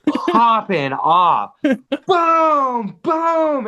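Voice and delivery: excited kid mimicking explosions; imitated fireworks booms. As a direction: boom it out like fireworks overhead.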